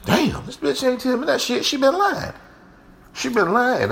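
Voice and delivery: high, hurt, betrayed complaint; jealous indignation. Retold or imitated. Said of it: "It's along the lines of".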